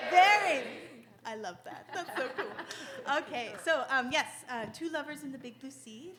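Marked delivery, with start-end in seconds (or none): 0.1-0.6 loud laughing cry of very; laughing shout. Retold or imitated. Sister echoing the audience answer, loud laughing shout.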